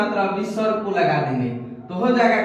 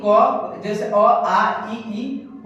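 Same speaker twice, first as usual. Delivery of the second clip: slow, drawn-out letter sounds; slow demo; much slower than usual. Shown as a demonstration. Teacher shows pupils the vowel sounds, slowly. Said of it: "आइए friends देखते हैं".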